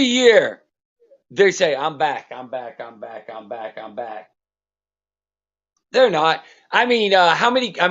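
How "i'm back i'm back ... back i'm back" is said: hammed-up rising chant of I'm back, fading to a mumble; mock the fans' yearly boast, dying away; fading sing-song I'm back; mocking Texas fans. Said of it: "I'm gonna say it like this right here".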